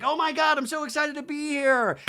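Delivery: high, squealy put-on thrill; pretend excitement. How I would react on stage, high pretend excitement. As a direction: gush as if just brought on stage. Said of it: "exactly how I would react".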